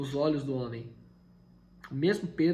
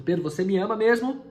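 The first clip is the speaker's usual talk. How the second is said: louder, higher, leaning on mesmo; pressing question; 7 semitones higher, 8 dB louder than usual. Acted. Jesus presses Pedro a third time, loud and rising.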